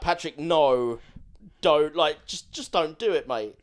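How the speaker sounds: loud exasperated plea; pleading shout. Retold or imitated. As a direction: beg him to stop, loudly. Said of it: "you're like".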